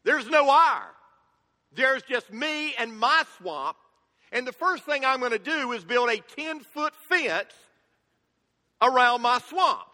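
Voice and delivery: guard your swamp, annoyed; grumpy Shrek. Retold or imitated. Pastor voicing Shrek refusing to share his swamp. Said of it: "Shrek answers and says".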